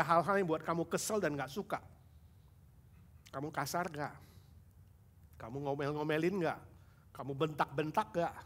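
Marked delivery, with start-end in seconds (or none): none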